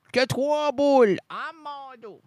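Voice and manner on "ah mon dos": soft pained groan, hand on the back; aching back sigh. Acted. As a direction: groan about a sore back, softly. Character groans about back.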